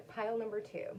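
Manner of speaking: ordinary talk, nothing marked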